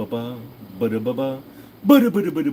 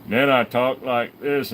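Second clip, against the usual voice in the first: slow, deliberate, no Southern accent, stressing "I"; careful neutral accent; slower than usual. Shown as a demonstration. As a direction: iron out the Southern accent with effort. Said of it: "concentrate to not talk like that".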